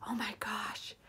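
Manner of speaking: soft hushed whisper; whispered gasp of wonder; awed whisper. Retold or imitated. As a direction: breathe it out in awe. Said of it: "You're like".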